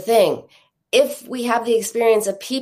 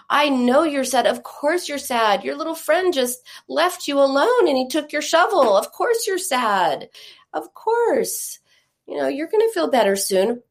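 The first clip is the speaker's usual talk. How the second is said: put-on higher, sympathetic soothing of a child; validating mom; 5 semitones higher than usual. Ideal mom to child whose shovel was taken, validating. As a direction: acknowledge the hurt, then reassure.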